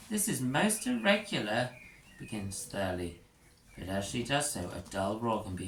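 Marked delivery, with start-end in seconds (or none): 0.1-1.7 disapproving. Acted. Teacher Thurley disapproves.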